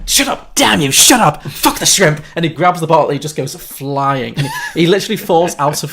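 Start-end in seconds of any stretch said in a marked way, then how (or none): 0.1-2.2 higher, louder hammed-up rant; mock furious yell. Stressed host snapping at Agador, mock angry yell. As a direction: bark it like a panicking host. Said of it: "He's like".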